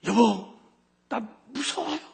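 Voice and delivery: high, frightened tearful voice; a wife breaking down in fear, voiced by her husband; scared crying plea. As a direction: retell the wife's frightened plea through tears. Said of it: "울음을 터뜨리면서 하는 말이".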